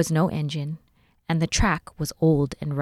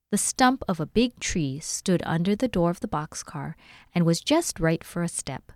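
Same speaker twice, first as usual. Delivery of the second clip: plain reading voice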